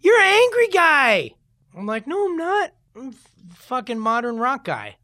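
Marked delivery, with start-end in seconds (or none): 0.0-1.3 high, loud mock-baffled yelling; outraged fans. Fans protest the singer's new pop song, loud mock outrage. 2.1-5.0 high-pitched, exasperated retort; mock protest. Singer answers back to his fans, high mock protest.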